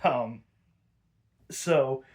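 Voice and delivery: normal talking voice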